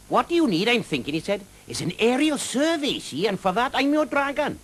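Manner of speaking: high, lilting Welsh dragon voice, friendly and teasing; a jolly Welsh dragon drawing out his words. Acted. Welsh dragon offering help.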